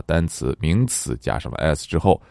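ordinary delivery

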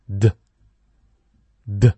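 d with the voice buzzing, no vowel after; voiced d sound. Shown as a demonstration. Teacher contrasting d against t for students. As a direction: make the vocal cords hum on d. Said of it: "声带震动".

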